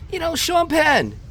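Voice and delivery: high, loud retold insistence. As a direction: draw it out loudly to jog a friend's memory. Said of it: "I kinda said it out loud".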